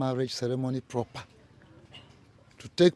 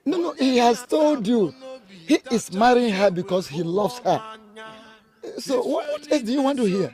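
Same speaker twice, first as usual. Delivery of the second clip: impatient, raised voice; exasperated; 10 semitones higher, 5 dB louder than usual. Acted. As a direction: brush off the doubts sharply. Mother snaps at questioning queen.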